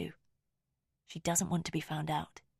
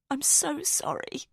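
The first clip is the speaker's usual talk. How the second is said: slow, breaking apology, higher; voice cracking; 9 semitones higher, slower than usual. A narrator's reading. Narrator apologises to Rosamond through rising tears, voice breaking. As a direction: let the voice crack as tears come. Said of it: "my voice cracking".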